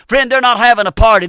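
ordinary talk, nothing marked

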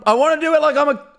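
loud, high whining outburst; whiny protest. Real, not acted. Loudly protesting a chat request.

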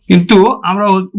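ordinary talk

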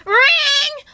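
high loud ringing bell sound; imitated bell. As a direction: ring out like a bell.

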